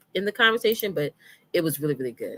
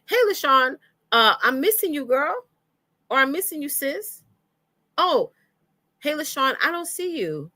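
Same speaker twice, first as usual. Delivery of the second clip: sample friendly email; 9 semitones higher than usual. Acted. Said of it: "you should say".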